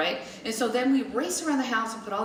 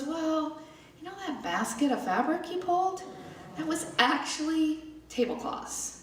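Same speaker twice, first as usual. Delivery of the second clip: higher-pitched, hesitant imitation of dad; awkward explanation; 8 semitones higher than usual. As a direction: deliver bad news gingerly, pausing as you go. Dad gingerly explains the mistake.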